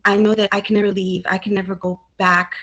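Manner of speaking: ordinary delivery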